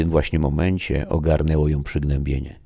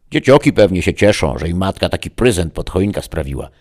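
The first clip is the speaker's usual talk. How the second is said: loud, high spiteful village gossip; 4 semitones higher, 9 dB louder than usual. A narrator's reading. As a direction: sneer it out, much higher and louder. Wife jeers at the orphans. (złośliwa, głośna plotkara)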